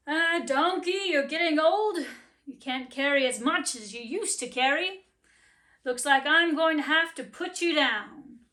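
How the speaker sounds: a stern owner breaking bad news; gruff master. Master voiced in story.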